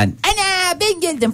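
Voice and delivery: very high, drawn-out silly squeal; clownish antics. Acted. Radio host imagining the film hero's comic return, clownish squeal. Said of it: "böyle bir şebeklik falan".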